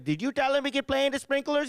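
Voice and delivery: high mock interrogation; mad mom. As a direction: accuse like an angry Indian mother. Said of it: "She's like".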